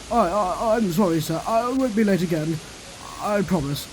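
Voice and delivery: stammering, contrite promise; chastened and nervous; sheepish apology. A narrator's reading. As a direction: stumble into a meek apology. Gordon apologises to his boss.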